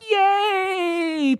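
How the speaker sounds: squeaky mock cheer; a silly high little celebration; high mocking cheer of self-congratulation; mock yay. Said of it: "His response is not like".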